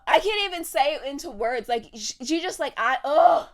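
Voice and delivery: loud, high, sputtering frustration; too exasperated to finish the thought; sputter, then explode in a groan; worked up. Real, not acted. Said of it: "Let me calm down".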